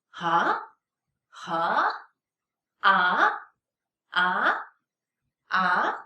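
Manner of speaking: "Huh? Ah?" starting low and swooping up; second tone. Shown as a demonstration. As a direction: ask "Ah?" like a big question mark. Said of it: "It's a big question mark".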